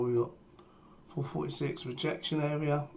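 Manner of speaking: normal talking voice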